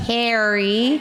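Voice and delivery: "Harry" in a mock American accent. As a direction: say "Harry" the American way, like a kid translating for school. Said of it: "it's like he has to translate".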